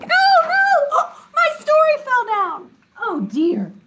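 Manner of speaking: high-pitched, startled oh no; real squealed dismay. Real, not acted. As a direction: cry out as it all falls down. Flannel board pieces fall down.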